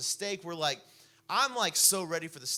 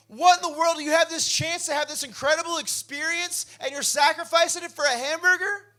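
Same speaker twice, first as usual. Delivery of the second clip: loud, high, exasperated retold outburst; raised voice; 12 semitones higher, 10 dB louder than usual. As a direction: let your voice climb in disbelief at him. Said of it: "my voice is getting ra-, raised".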